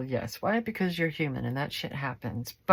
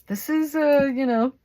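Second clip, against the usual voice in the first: excited; 9 semitones higher, 10 dB louder than usual. Real, not acted. Sudden loud high exclamation.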